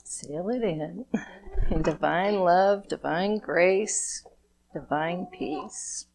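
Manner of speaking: calm, measured blessing prayer; gentle ceremonial invocation; blessing ritual. Acted. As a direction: bless each chakra slowly, like a prayer. Leads a chakra blessing ritual.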